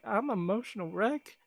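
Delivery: playful whine of being an emotional wreck; hammed-up self-pity; mock despair. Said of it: "I was like".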